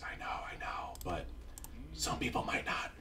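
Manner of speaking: hushed, whispered concession; whispered aside. Pretend. YouTuber answering viewers who already know this, whispered.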